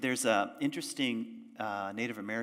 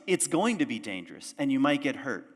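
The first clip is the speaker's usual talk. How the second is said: ordinary talk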